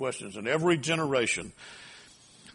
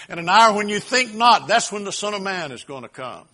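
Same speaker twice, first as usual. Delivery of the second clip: higher, louder preacher's insistence; impassioned preaching; 5 semitones higher, 7 dB louder than usual. Real, not acted. Preacher correcting a date-setter from scripture, impassioned. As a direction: drive the point home at full voice.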